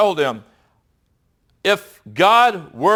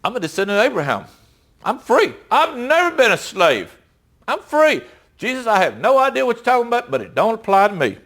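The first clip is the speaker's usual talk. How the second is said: higher, quick, indignant mock crowd protest; 6 semitones higher, faster than usual. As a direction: play the offended listeners insisting they're free.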